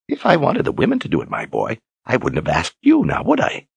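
put-on high, dry old man's retort; high teasing rise at the end; wry old wizard. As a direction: needle the boy with a sly question.

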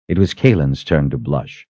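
usual speaking voice

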